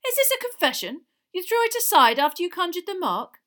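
high, loud accusing questions. A narrator's reading. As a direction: press for a confession. Diggory accuses Harry.

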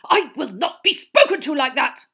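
loud furious uncle; trembling with rage. A narrator's reading. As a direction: shake with rage. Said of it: "trembling with rage".

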